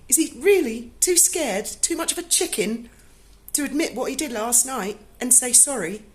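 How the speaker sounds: high-pitched, incredulous indignation; indignant. Retold or imitated. Kitten's owner venting to Duncan's boss, indignant and high.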